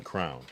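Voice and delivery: "crown" one syllable rhyming with town; crayon said as crown. Shown as a demonstration. Streamer listing ways to say crayon, said as crown. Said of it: "or crown".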